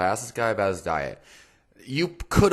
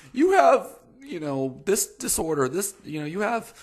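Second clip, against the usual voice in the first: high-pitched mock diagnosis; put-on high voice, fumbling for a diagnosis; mocking lazy professional; 11 semitones higher than usual. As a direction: parody a glib professional up high. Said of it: "I hate professionals who just".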